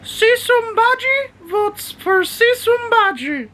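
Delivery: very high, slow squeaky voice for Bodhi; high-pitched dog voice. Pretend. Owner voices his dog Bodhi quoting the pirate scene, very high and slow. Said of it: "go high pitch voice and I start doing Bodhi's voice".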